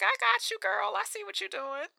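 high, soft, knowing voice; conspiratorial high-pitched murmur to a girlfriend; knowing aside. Retold or imitated. Fan telling the singer she understands, knowing and hushed. Voicing own knowing reaction.

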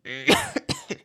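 faint breathy cough; weak cough. Acted. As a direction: cough like a sick old man. Sick king coughs.

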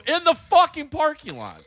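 loud, high retort; exasperated. Retold or imitated. Answering her question about where he is, exasperated. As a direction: fire it back in mock fury. Exasperated phone argument retold.

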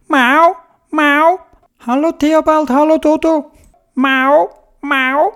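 high playful kitty voice; cat voice. Acted. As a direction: meow like a kitten. Cat character voice.

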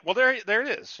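high, wry well there it is; mock aha. Talking about Clark, an amused mock aha. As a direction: pitch it up like catching them out. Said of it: "I'm like".

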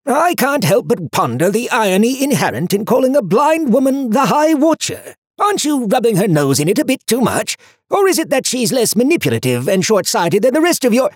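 high, loud pedantic book voice; fussy. A narrator's reading. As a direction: lecture like a prissy know-it-all. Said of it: "Harriman's fussy voice".

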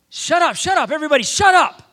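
high, very loud repeated yell; yelling for silence. Retold or imitated. Young woman silencing her partying friends, full yell. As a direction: shout down a noisy table.

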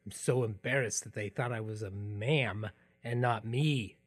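ordinary talk, nothing marked

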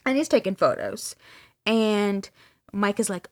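ordinary delivery

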